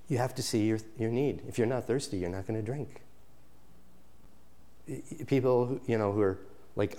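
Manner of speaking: hushed, earnest sermon; soft preaching. Acted. Soft pulpit teaching.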